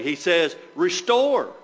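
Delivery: "restore" slowed and firmly stressed. Shown as a demonstration. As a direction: drive home "restore" slowly. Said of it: "You need to emphasize the word restore".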